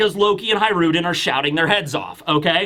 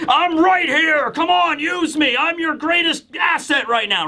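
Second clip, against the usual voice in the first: shouting; 7 semitones higher than usual. Retold or imitated. Loki begging Hiruden to use him, imitated shouting. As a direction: belt it out like a desperate prisoner. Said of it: "He's just shouting and screaming".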